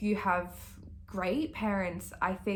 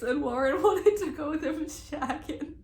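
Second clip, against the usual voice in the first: ordinary talk, nothing marked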